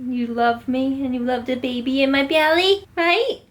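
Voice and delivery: high, cooing voice for a pet. Real, not acted. Mom-to-be cooing baby talk at her dog. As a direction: coo at the little dog.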